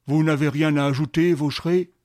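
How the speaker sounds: loud, stern courtroom judge; judge's question. Acted. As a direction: boom the question across the court. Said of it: "le président des assises demanda".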